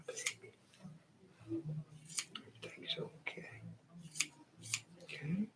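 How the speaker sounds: hushed, whispered reassurance; calm the frightened dog softly; soothing a scared dog. Real, not acted.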